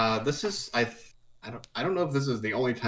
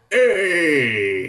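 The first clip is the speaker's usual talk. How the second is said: high, loud, drawn-out "hey"; imitated call; 6 semitones higher, 5 dB louder than usual. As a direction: mimic someone hollering hey. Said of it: "Stop going".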